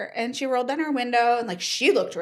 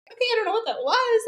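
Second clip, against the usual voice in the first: bewildered rush; 13 semitones higher, 4 dB louder, faster than usual. Retold or imitated. Bewildered after odd encounter.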